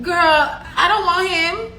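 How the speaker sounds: high-pitched, mildly indignant retold comeback; acted-out sassy denial; dismissive retort. Retelling her quarrel with friend.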